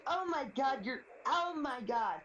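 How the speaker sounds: high, exasperated "oh my God", imitating a son; mock yelling son. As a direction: drag out the last one in mock outrage. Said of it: "he yelled at me".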